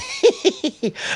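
high-pitched burst of giggling; high giggle. Real, not acted. Co-host amused by the sign-off summary, high giggle.

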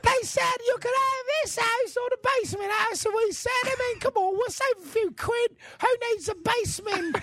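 silly squeaky mock British; hammed-up homeowner in a high squeak. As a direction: stretch it out in a put-on squeak. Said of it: "high squeaky voice convention".

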